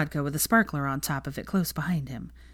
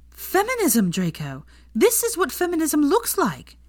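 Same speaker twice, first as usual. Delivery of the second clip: high, excited exclaiming; waving it in his face, thrilled; exclaimed; 11 semitones higher, slower than usual. A narrator's reading. Hermione exclaims to Draco, high and excited. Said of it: "she exclaimed".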